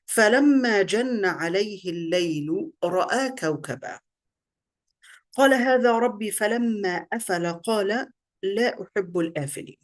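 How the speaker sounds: melodic Arabic verse recital; lilting Quranic recitation. Acted.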